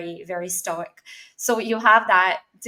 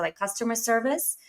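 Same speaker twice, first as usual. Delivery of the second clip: ordinary talk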